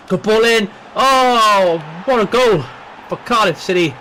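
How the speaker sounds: loud, high goal shout; excited goal call. Acted. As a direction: scream the goal like a commentator.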